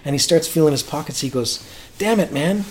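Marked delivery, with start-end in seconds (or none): 2.0-2.6 put-on high, exasperated Memphis accent. Singer finding his pockets empty, imitated exasperated Memphis accent. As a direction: imitate an indignant Southerner, high-pitched. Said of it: "in his Memphis voice".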